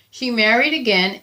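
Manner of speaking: plain reading voice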